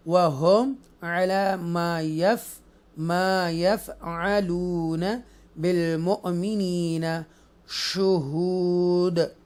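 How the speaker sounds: slow chanted tajweed reading; measured chanted recitation; Quranic recitation. Shown as a demonstration. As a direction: recite it slowly and melodically. Recites verse in lesson.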